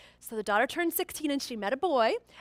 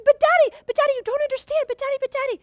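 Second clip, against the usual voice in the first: put-on much higher, louder desperate teen plea; pleading daughter; 12 semitones higher, 7 dB louder, faster than usual. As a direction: beg your dad to listen.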